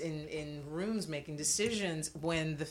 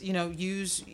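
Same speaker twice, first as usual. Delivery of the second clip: ordinary delivery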